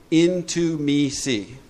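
very slow, word by word; spelled out slowly. Shown as a demonstration. Explaining intimacy to the group, very slow. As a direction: let each word land.

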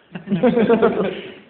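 loud burst of laughter; big audience laugh. Real, not acted. Crowd laughing loud at the sponsor joke.

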